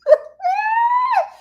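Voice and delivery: high, loud burst of laughter; shrieking laugh. Real, not acted. Laughing at terrible song.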